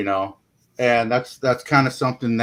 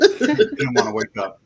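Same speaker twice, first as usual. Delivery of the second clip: laughing; 14 semitones higher, 4 dB louder, slower than usual. Real, not acted. Laughing about snoring wife.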